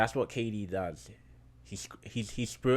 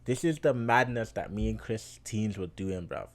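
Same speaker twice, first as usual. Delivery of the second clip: ordinary talk, nothing marked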